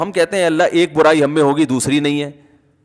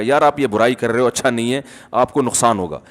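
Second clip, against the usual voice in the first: lower, softer, quick private counsel; gentle advice; 6 semitones lower, 5 dB quieter than usual. Retold or imitated. Reformer takes friend aside, gentle advice. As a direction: talk kindly to someone doing wrong.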